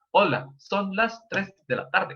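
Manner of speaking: a hint of automated voice, slowed down; Google voice assistant. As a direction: announce the time like a talking phone, unhurried. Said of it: "hay un acento de Google".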